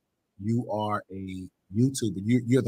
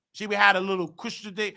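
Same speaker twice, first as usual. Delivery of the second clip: usual speaking voice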